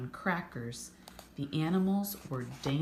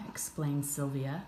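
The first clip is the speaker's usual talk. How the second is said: usual speaking voice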